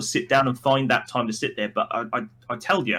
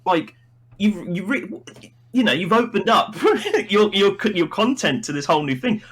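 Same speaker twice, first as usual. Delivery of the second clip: normal talking voice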